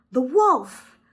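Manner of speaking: loud, high alarmed exclamation; discovering the wolf at the door; startled cry. Acted. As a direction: gasp it out in shock. Bunny spots the wolf.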